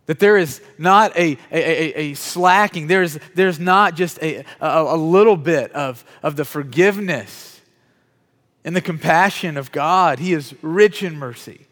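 loud, slow, weighty preaching; forceful preaching. Acted. Preacher telling congregation of God's mercy, forceful preaching. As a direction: lean hard on each phrase, drawing it out.

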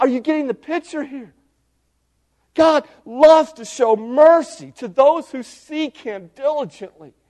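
high-pitched, excited exhortation; excited preaching. Real, not acted. Preacher asking the folks if they get the picture, excited. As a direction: preach it thrilled, pitch well up. Preacher thrilled by verse on mercy.

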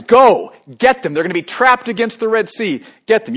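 loud, high barked order to the armies; commanding shout. Acted. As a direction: yell the order like a furious Pharaoh. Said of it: "he sends his armies out".

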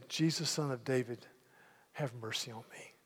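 soft, humble plea; quiet prayer. Acted. Preacher praying as blind Bartimaeus, soft and humble. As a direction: pray it softly to yourself. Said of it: "and I say".

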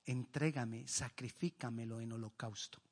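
hushed voice of God. Acted. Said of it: "Dios le dice".

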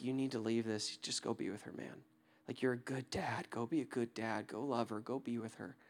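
soft, close, intimate encouragement; hushed tender urging. Retold or imitated. To the stranger on the island, soft tender urging. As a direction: talk him back to his daughter, gently.

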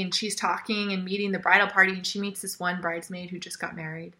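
ordinary talk

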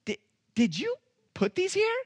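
high, disbelieving; can't believe it; incredulous question. Retold or imitated. Preacher to the officer, high with disbelief. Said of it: "I go".

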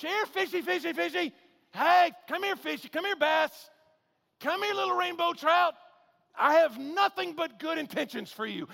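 high, loud sing-song calling to a fish; coaxing fisherman. Retold or imitated. Fisherman coaxing fish with bare hook.

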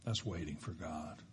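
quiet closing line. Shown as a demonstration. Preacher closing the point, hushed and low. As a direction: let it land softly.

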